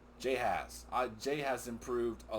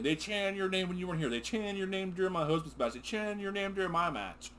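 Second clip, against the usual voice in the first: higher, mock-indignant Becky quote; imitating Becky; 7 semitones higher than usual. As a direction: play her complaint with a touch of outrage. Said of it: "was that they always chanted".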